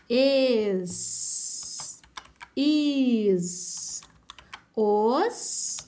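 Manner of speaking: "es", "is", "os" each drawn out into a held S; vowel-first reading. Shown as a demonstration. Teacher reading vowel before S, sounded-out "es, is, os". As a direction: sound out "es", "is", "os" one at a time, slowly. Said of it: "começar a ler pela vogal".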